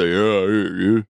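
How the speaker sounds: put-on low drunken mumbling rocker; lightly voiced mumbling drunk; drunk mumble. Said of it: "drunk mumble, deep voice [name]".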